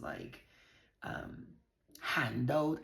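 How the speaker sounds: normal talking voice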